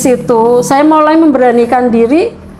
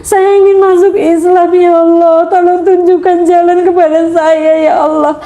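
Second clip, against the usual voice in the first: higher, tearful pleading to God; crying prayer; 5 semitones higher than usual. Retold or imitated. A convert weeping to Allah, crying and pleading. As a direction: beg God through tears, voice raised and breaking. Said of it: "Saya sering menangis".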